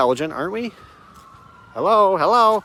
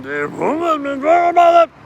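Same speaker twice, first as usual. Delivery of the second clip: much higher, thin and quick; squeaky mock voice; 12 semitones higher, 5 dB louder than usual. Complaining to the camera about being silenced, high squeak. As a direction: whine it up high like a cartoon. High far take before the shout.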